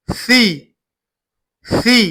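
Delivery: "सी" said with the tongue tip out, like English th; teacher demonstrating the Arabic tha in "सी"; tongue between teeth. Shown as a demonstration. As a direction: bite the tongue lightly on "सी". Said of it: "जुबान का अगला हिस्सा बाहर निकालकर".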